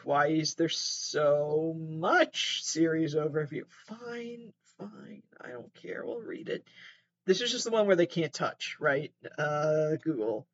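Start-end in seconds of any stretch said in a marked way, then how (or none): 3.9-6.6 whispered, resigned mutter under her breath; grudging whisper. Real, not acted. Resigned whisper to herself about reading the overview. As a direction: give in with a whispered sigh.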